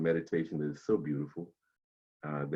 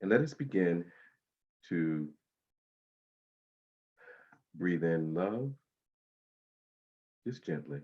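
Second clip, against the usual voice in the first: guided meditation. Acted. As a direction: ease them into calm breathing.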